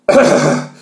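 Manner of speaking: loud rough cough; harsh cough. Real, not acted. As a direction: cough hard from the burn. Chili coughing.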